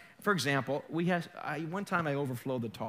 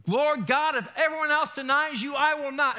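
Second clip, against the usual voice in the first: loud, high-pitched declaration of loyalty; emphatic vow; 9 semitones higher, 6 dB louder than usual. Acted. Preacher acting out Peter's bold vow to God, emphatic. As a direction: proclaim it at raised volume.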